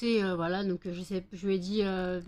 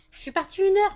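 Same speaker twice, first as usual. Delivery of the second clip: much higher, louder exasperated cry; exasperated high outburst; 11 semitones higher, 8 dB louder than usual. Real, not acted. Scene: owner exasperated by dogs jumping after one hour away, high loud. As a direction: pitch it up in exasperation.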